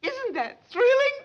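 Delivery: high, slow thrilled whisper-gush; excited. Acted. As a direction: point her out breathlessly. Spots the princess.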